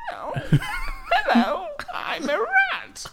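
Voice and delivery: very high, slow squeaky rat. Pretend. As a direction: squeak it out like a talking toy rat. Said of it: "It's like your rat's voice".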